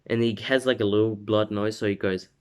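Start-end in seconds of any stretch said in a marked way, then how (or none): none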